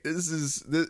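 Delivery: ordinary delivery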